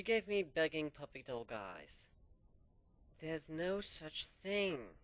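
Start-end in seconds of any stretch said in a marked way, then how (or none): 3.2-5.0 very slow, deliberate, each word set down; slow emphatic dismissal; slow firm denial. Acted. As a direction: spell it out to the silly friend.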